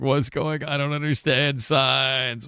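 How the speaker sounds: high, drawn-out imitation of someone losing it; mock tearful meltdown. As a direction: wail it slowly, as if imitating someone in tears. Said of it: "in tears, losing it, being like".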